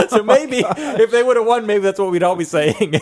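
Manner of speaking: high, loud, laughing through the words; real giggles while talking. Real, not acted. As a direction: chuckle out the dark joke. Laughing at own joke.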